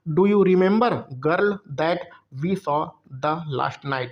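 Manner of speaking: each English word spelled out slowly; very slow, deliberate classroom reading; slow dictation read. Shown as a demonstration. Teacher reads exam sentence aloud.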